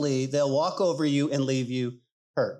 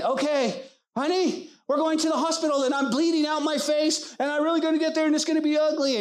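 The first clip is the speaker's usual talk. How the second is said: urgent panic; 10 semitones higher, faster than usual. Retold or imitated. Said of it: "That's like me saying".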